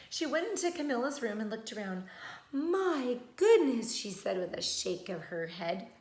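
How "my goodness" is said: slow, louder drawn-out dismay; lightly voiced old woman tutting; old woman's dismay. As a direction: stretch the goodness like a fussy granny. Old woman sizes up the case.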